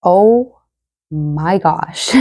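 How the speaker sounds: normal talking voice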